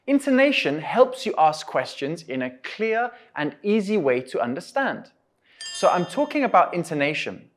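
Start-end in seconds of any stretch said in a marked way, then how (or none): none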